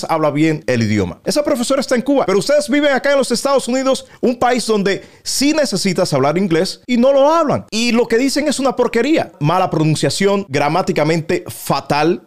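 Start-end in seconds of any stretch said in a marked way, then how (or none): 1.2-9.3 higher and faster, indignant outburst; heated rant. Real, not acted. YouTuber scolding the mockers, heated and fast. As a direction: fire it back at them, rising and quick.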